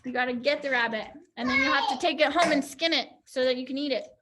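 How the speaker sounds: ordinary delivery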